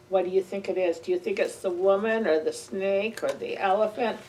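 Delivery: plain reading voice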